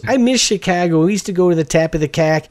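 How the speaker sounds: imitating an ex's Chicago accent. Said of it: "what is that they say in that Chicago accent".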